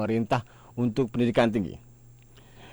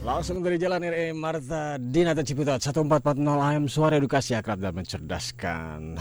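radio announcer; 4 semitones higher than usual. Acted. Announcer station ID.

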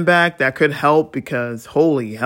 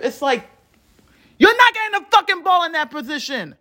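high-pitched frustrated tirade; heated rant; 9 semitones higher than usual. Real, not acted. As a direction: rant it faster and higher as it boils over. Furious rant about final drive.

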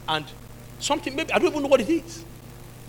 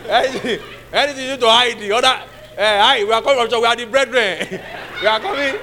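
loud, raised voice breaking into laughter; mock visitors' announcement; 3 semitones higher, 6 dB louder than usual. Church brethren warning a friend they are coming, loud laughing announcement. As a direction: call out jokily to a whole house.

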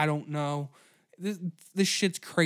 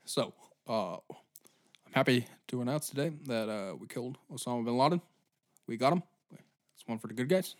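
low, soft presidential address voice; lightly voiced president at a podium; Obama impression; 4 semitones lower, 4 dB quieter, faster than usual. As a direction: deliver it like a calm press statement. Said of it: "imagine Obama went and he's like".

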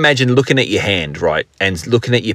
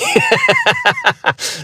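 high laugh. Real, not acted. Laughing at himself while thanking listeners.

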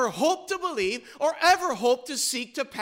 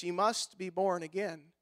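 soft solemn; 6 semitones lower, 8 dB quieter than usual. Acted. Jesus answers Nicodemus softly.

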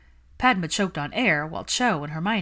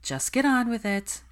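higher-pitched, mildly weary sigh; 7 semitones higher than usual. A narrator's reading. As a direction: sigh it out, resigned to the questioning. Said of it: "Hermione sighed".

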